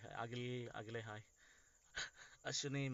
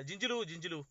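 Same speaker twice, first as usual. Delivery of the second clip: louder, higher call for the audience; raised voice; 8 semitones higher, 7 dB louder than usual. Real, not acted. Live host testing the sound for viewers, raised voice. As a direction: speak up to be heard. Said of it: "കുറച്ചും കൂടെ സൗണ്ടിൽ പറയാം".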